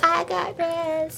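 slow high creepy taunt; menacing drawn-out mock voice. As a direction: stretch it out like a cartoon villain. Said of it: "She's like".

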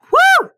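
high, loud joyful whoop; a shrieking celebratory woo. Pretend. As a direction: cheer like the ride just ended. Imagined deathbed celebration.

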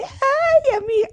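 high squealed mock excitement; gushing excitement. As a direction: gush to a girlfriend in a high voice. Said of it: "no se emociona así". (emoción exagerada y aguda)

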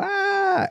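a sudden high flying "ah"; mock joyful cry. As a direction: cry out like soaring through the air. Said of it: "and you go".

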